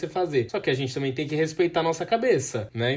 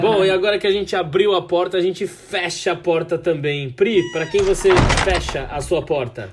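upbeat host segment intro, louder; announcer voice; 3 semitones higher, 7 dB louder than usual. Acted.

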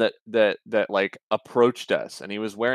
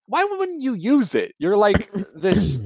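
incredulous; 10 semitones higher, slower than usual. Retold or imitated. Exasperated retelling, incredulous.

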